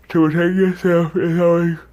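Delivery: words stretched by a yawn. Real, not acted. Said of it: "I don't know why I keep yawning".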